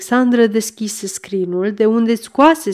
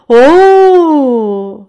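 long swooping "oh", higher and louder; whistling exclamation; 5 semitones higher, 6 dB louder than usual. A narrator's reading. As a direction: let it glide down like an amazed whistle. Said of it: "șuierând ca de obicei". (exclamație prelungă de uimire, ca un șuierat)

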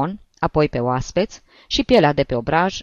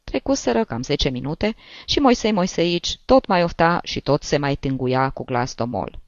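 usual speaking voice

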